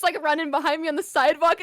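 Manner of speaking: ordinary talk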